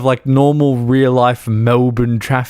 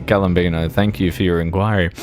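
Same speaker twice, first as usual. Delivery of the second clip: 1 mock formal reply; 7 semitones lower, faster than usual.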